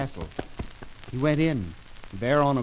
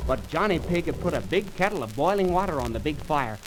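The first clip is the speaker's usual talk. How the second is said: ordinary delivery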